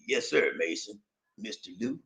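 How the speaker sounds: deep, very soft read-out; low hushed voice. Acted. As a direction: say it low and quiet. Reads message in low voice.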